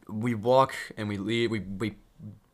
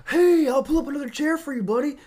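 high, bright-eyed recruiter pitch, louder; eager recruiter; 5 dB louder than usual. Retold or imitated. Cult recruiter welcoming a new victim, eager and high. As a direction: chirp it like a salesman spotting a mark. He says.

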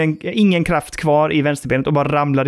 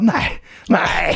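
mock despair; 4 semitones higher than usual. His reaction to broken leg.

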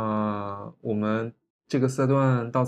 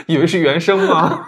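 笑着说; 6 semitones higher, 12 dB louder than usual. Real, not acted. 笑着调侃对方配音.

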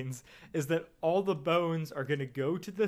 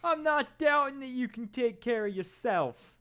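put-on high-pitched old manager; 7 semitones higher than usual. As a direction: plead with the boxer, higher voice. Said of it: "act it out here".

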